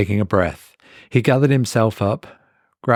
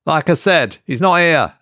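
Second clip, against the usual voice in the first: higher, a bit louder, firm "not"; dismissive woman; 7 semitones higher, 4 dB louder than usual. A narrator's reading. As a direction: shut him down again. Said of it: "she repeats herself, dismissive".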